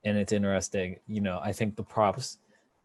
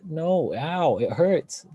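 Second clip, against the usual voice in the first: higher-pitched playful cry of pain; mock pained wince; 7 semitones higher, 4 dB louder, much slower than usual. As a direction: wince out loud in sympathy, hammed-up. Reacting to watching him do splits.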